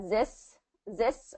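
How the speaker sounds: this said as zis, a z instead of th. Shown as a demonstration. Tutor showing the common mistake to the learner, zis. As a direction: swap the th in this for a z. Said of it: "instead of this".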